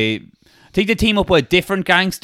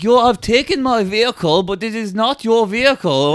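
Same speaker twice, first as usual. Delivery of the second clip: put-on higher, protesting German accent; Hans; 5 semitones higher than usual. German guy confronts the men who took his vehicle, high German accent. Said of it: "Hans is like that".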